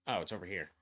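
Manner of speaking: ordinary talk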